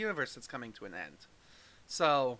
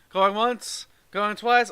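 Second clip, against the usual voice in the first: auctioneer; 3 semitones higher, 7 dB louder, slower than usual. Pretend. Auction-style countdown to end show.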